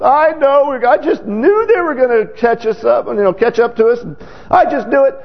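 mock a sulky kid sure he'll lose; whining like a baby. Preacher mimics his boyhood self whining in the dugout. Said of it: "whining like a baby".

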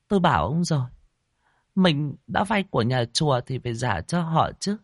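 high, shaken wife's voice; frightened wife. A narrator's reading. Wife tells husband she dreamed it too, frightened.

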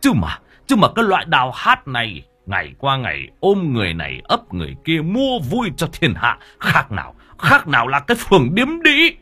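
angry tirade surging into high shouts; relentless scolding. A narrator's reading. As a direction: rail at his wife, spitting out the insults. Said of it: "quay sang mà chửi vợ xa xả". (chửi xa xả)

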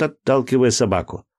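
usual speaking voice

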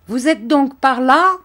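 calls out high and loud from a distance; the count hails them loudly, head bare; loud high call across the garden; count's shout. Acted. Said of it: "cria le comte".

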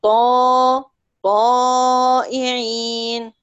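طائعين said slowly with a heavy, full alif. Shown as a demonstration. Teacher gives a second example of heavy alif. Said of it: "الألف هنا مفخمة".

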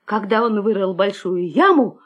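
ordinary delivery

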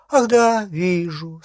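high, loud onset that settles down; bewitched agreement. A narrator's reading. Travers under a spell agreeing with Hermione, suddenly compliant. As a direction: start high and let it slide down.

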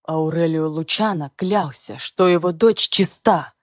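hard clipped metallic male voice. Acted. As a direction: rap out each word like metal. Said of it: "Металлическим голосом чеканит Максимилиан".

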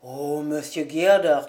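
low, grave admonishment; solemn minister. A narrator's reading. Minister reproves the husband.

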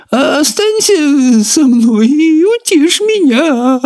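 very high, slow pleading snail voice; whimpering snail. A narrator's reading. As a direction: whine for comfort as the snail. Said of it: "захныкала улитка".

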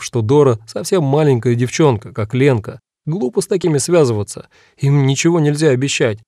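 plain reading voice

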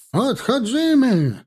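high, drawn-out Polish retreat call; shouted foreign command. A narrator's reading. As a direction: cry the retreat slowly across the field in Polish. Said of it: "команда на чужом языке". (протяжный высокий крик по-польски)

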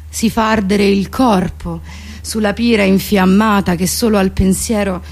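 usual speaking voice

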